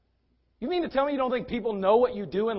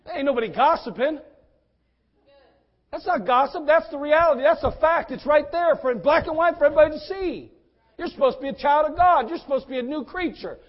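loud, raised-pitch pulpit rebuke; fired-up preaching; 7 semitones higher, 6 dB louder than usual. Real, not acted. Preacher correcting church members who cry gossip, fired-up preaching. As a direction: drive each point home forcefully from the pulpit. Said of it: "now I'm preaching".

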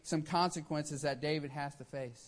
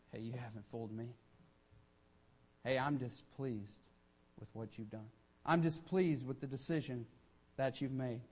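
the Lord speaking low and grave; quiet voice of the Lord; 3 semitones lower, 6 dB quieter than usual. Retold or imitated. The Lord rebuking the sinner, a hushed stern voice. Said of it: "how many times does the Lord say".

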